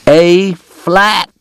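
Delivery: high, loud correction; a drunk man yelling at a pianist; shouting. Acted. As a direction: bark the note at the piano player. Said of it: "Doc shouted".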